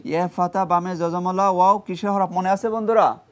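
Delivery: usual speaking voice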